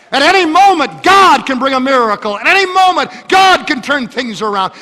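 high, loud, driving preacher's call; rising fiery sermon cry; full-force preaching. Acted. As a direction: exhort the seniors at the top of the voice.

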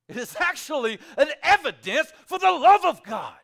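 high, loud proclamation; proclaimed loudly. Acted. Preacher declaring love to the congregation, loud. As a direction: shout out God's love. Climactic sermon line.

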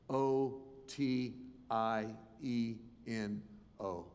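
slow, deliberate spelling of O T I E N O; slow spelled letters. Retold or imitated. Spelling name seen on eyelids.

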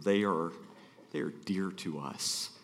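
holding back tears while praising a friend; choked up. Real, not acted. Guest speaker moved by his love for the pastor's family, choked up. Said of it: "I'm getting choked up".

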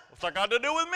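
high, loud mock protest; mock indignant listener. Pastor mimicking a defensive listener, high and loud. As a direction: play a put-out churchgoer. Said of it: "a couple of people are going".

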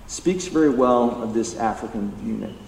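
ordinary talk

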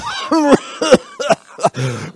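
high, loud nervous laugh. Acted. Peter brushing off the servant girl, nervous high laugh. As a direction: laugh it off in a panic.

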